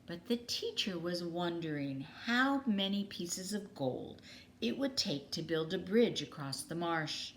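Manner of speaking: ordinary delivery, nothing marked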